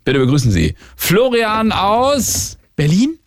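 slow, drawn-out announcer build-up; grand entrance announcement. Shown as a demonstration. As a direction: announce the guest like a showmaster. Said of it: "Ich wollte so ein bisschen aufbauen".

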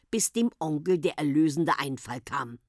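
ordinary delivery, nothing marked

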